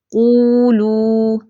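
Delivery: "قولو" recited very slowly, both "oo" sounds held level; waw elongation. Shown as a demonstration. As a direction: stretch each waw in "قولو". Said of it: "واو پر آواز کب کھنچتی ہے". ("قولو" میں واو کو کھینچ کر)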